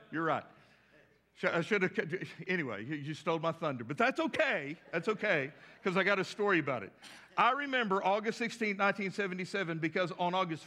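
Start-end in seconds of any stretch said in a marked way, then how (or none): none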